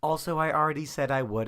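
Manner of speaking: high, loud, irritated retort; irritated outburst; annoyed. Acted. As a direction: push back, fed up at not being heard. Annoyed woman repeats herself in story.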